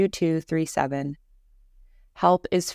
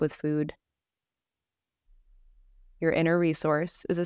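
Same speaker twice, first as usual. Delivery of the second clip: calm, even voice with long pauses; guided meditation. Acted. Leading listeners through Yoga Nidra practice, guided meditation voice. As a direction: gently guide the listener into rest, leaving space between phrases.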